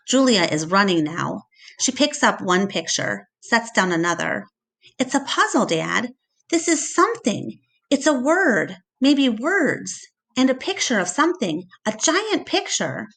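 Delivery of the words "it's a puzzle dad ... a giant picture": higher, eager discovery voice; excited girl. A narrator's reading. Julia tells her dad about the puzzle, excited and higher. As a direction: rush through the discovery, thrilled.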